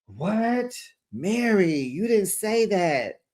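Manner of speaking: high, stretched scandalized disbelief; theatrically appalled reaction; mock shock. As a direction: act aghast, drawing the words out high. Acted shocked reaction to Mary's insult.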